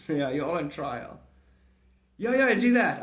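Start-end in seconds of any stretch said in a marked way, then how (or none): 2.2-3.0 excited call. Real, not acted.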